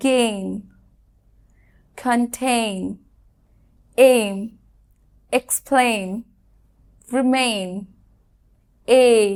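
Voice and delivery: the sound এই drawn out in each word; model pronunciations. Shown as a demonstration. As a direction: stretch out the এই in every word. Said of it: "ঠিক যেরকম".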